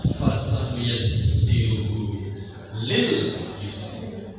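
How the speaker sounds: slow melodic tilawah; Quranic recitation. Acted. Preacher reciting Surah al-Lail to the audience, slow melodic chant. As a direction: stretch the vowels, chant the verse.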